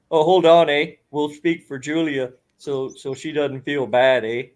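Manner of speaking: hammed-up Canadian hoser accent, heavy on the eh; Bob McKenzie Canadian accent. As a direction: play a jokey Canadian hoser with an eh on every line. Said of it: "a perfectly good Rick Moranis doing Bob McKenzie".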